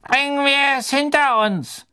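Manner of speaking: put-on high, thin voice of an old official; reedy old committee man, impatient; frail old man wanting it over. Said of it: "die dünne Stimme des alten Ausschussmitglieds".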